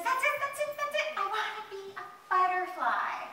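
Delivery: high, loud eager outburst; excited. Acted. Caterpillar sees a butterfly and makes a wish, excited. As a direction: burst out with sudden certainty.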